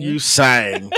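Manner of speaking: "You sang" said through a real laugh. Real, not acted. Laughing after the teasing.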